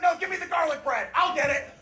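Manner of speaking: high, strained choking voice; choking while talking. Retold or imitated. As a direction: force words out past a stuck meatball. Choking dad answers his wife.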